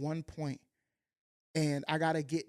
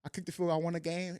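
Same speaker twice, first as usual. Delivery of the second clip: usual speaking voice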